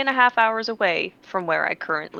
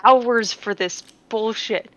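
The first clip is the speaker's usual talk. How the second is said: slow, higher outraged complaint; indignant vent; 4 semitones higher, 3 dB louder than usual. Real, not acted. Telling friends about the pointless drive, slow and outraged. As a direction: drag out the bullshit. Venting about wasted trip.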